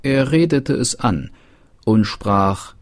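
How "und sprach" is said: ordinary delivery, nothing marked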